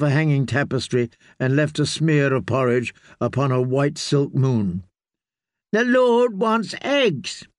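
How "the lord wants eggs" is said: put-on high, very slow demanding child voice; a little lord throwing a tantrum; demanding boy. A narrator's reading.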